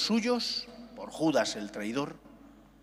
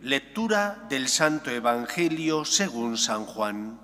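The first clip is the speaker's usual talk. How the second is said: solemn Gospel proclamation, louder; liturgical reading; 2 semitones lower, 4 dB louder than usual. Acted. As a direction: proclaim it from the pulpit. Priest proclaims the Gospel aloud.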